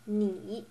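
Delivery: "ni" dipping then rising; third tone. Shown as a demonstration. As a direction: draw a check mark with the pitch on "ni". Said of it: "you're doing it on the third tone".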